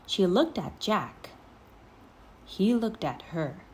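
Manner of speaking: plain reading voice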